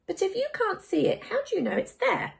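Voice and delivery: put-on a girl asking, unsure; lightly voiced child. Child character voice.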